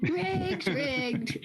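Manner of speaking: high echo of the complainers, then dropping; mock accusation. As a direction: chant it like protesting losers, playfully. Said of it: "They love saying it's rigged".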